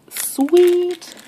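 high, loud squeal of delight; drawn-out, delighted cheer; thrilled exclamation. Real, not acted. Delighted by a skull piece.

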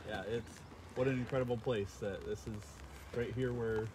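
ordinary talk, nothing marked

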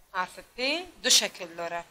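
usual speaking voice

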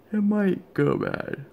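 slow, high words stretched by a yawn; real yawning. Real, not acted. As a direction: yawn right through the words. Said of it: "I yawned again".

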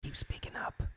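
very soft, furtive whisper of a secret supporter; imitated hush-hush confiding; secretive whisper. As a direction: whisper encouragement on the sly. Acts out closeted friends whispering support.

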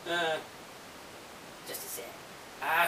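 ordinary talk

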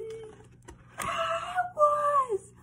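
very high, loud squeal; mock-frightened shriek. Reader reveals to children that it really was Wolf, mock-frightened shriek.

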